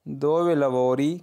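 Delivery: very slow, syllable by syllable; slow model sentence. Shown as a demonstration. Teacher first reading the question to learners, very slow. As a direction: spell it out slowly for the class. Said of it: "उन्हें इस तरह पूछ सकते हो".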